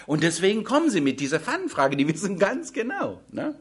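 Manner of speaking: usual speaking voice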